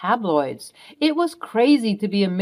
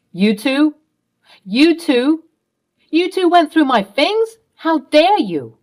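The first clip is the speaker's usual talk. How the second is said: higher and louder, furious at being found out; outraged shout; 7 semitones higher, 5 dB louder, slower than usual. Acted. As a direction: shout it like your privacy was violated, drag out the last line slowly. Tom confronted, finds things searched.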